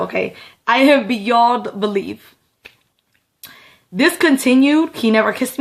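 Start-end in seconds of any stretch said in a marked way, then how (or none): none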